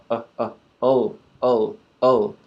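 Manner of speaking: ul said as a quick schwa then L; ul sound. Shown as a demonstration. Teacher showing the hidden vowel in L, quick. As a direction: clip ul short, quick uh into L. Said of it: "It sounds like uh".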